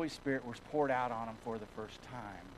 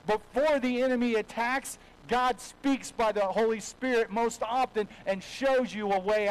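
full-force preaching; 7 semitones higher, 8 dB louder than usual. Acted. Pastor preaching to the congregation about the Holy Spirit, loud and rising. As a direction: drive it home from the pulpit, loud and lifted.

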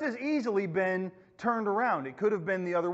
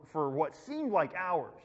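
ordinary talk, nothing marked

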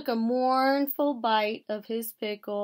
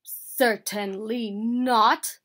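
emphatic denial; 8 dB louder than usual. A narrator's reading. Bear answering excited Mouse about presents, emphatically denying. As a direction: deny it firmly, word by word.

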